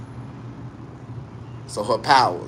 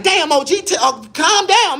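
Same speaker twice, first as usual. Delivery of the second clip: high alarmed plea; 14 semitones higher, 4 dB louder than usual. Retold or imitated. Calling to OG in bathroom.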